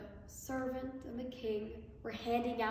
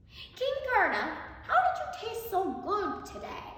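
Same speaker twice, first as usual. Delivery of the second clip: higher, louder, delighted praise; pleased hermit; 8 semitones higher, 3 dB louder than usual. A narrator's reading. Hermit tells the king how tasty he was, delighted and high. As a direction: savour the compliment.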